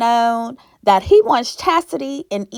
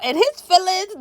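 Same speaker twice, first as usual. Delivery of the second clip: usual speaking voice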